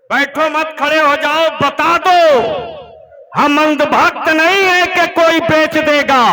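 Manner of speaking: high, very loud exhortation to a crowd; rallying shout. Real, not acted. Preacher urging unmarried men to stand up, shouting. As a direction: yell it out to get everyone on their feet.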